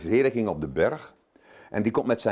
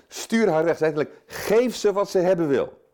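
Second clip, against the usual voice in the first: higher, louder exasperated brush-off; annoyed demand; 7 semitones higher, 4 dB louder than usual. Retold or imitated. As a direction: get rid of her, fed up. Disciples' demand voiced again.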